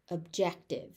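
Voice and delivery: ordinary delivery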